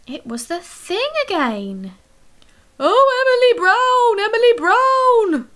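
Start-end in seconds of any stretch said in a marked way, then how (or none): 2.8-5.5 high, loud whiny monster wail; grumbling creature. A narrator's reading. The thing grumbling to Emily Brown, a high loud wail. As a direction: moan her name like a big fussy beast. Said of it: "grumbled the thing".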